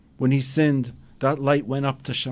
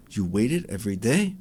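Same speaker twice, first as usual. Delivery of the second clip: a lightly voiced God, lower and softer, amused; God smiling; 4 semitones lower, 4 dB quieter than usual. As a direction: gently ask it as a smiling God. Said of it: "Hashem is gonna look at you and smile and say".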